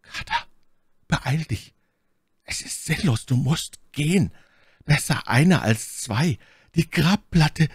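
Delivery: high, strained voice pressing him to go; urgent, fearful plea. A narrator's reading. Warren telling Carter to leave him, anxious urging. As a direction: plead urgently, pitch lifted. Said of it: "Doch sein nächstes Flüstern".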